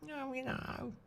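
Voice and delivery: tiny, high, very soft impression; David Beckham. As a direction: mimic a soft-spoken footballer, high and hushed. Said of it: "David Beckham, in the way he talks".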